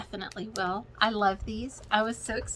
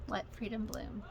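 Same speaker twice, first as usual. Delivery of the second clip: softer repeat; 8 dB quieter than usual. Shown as a demonstration. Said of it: "this is by Shepherd's Bush".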